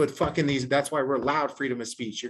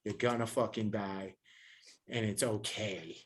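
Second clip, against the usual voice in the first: quiet, solemn; 5 semitones lower, 9 dB quieter than usual. Real, not acted. Solemn closing statement.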